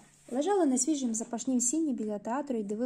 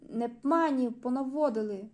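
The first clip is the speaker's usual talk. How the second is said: loud outraged protest, slowing at the end; indignant man refused entry, shouting at the ticket taker; 3 semitones higher, 6 dB louder than usual. Acted. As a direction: shout it like an offended gatecrasher. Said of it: "Фігура кричала".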